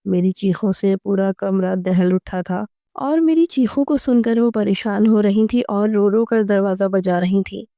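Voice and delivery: usual speaking voice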